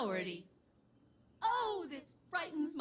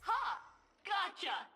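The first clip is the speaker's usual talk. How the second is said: triumphant; 8 semitones higher, slower than usual. Acted. Catches the specimen.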